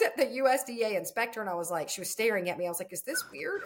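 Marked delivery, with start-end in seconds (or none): 2.9-3.6 high, soft questioning voice; self-conscious question. Retold or imitated. Crying farmer asks the inspector if this is weird, self-conscious. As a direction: ask it small and high, unsure.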